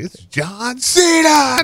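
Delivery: high, slow drawn-out announcer call; announcer parody. Retold or imitated. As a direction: stretch the name like a ring announcer. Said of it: "They're like".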